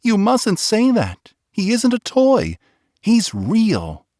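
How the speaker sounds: put-on a small boy insisting, outraged, his toy is real; indignant little boy. A narrator's reading. Boy protesting to Nana.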